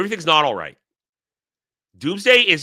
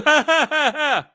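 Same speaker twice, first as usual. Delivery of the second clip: mocking laugh; 14 semitones higher, 3 dB louder than usual. Real, not acted. Derisive laugh at fans' claim.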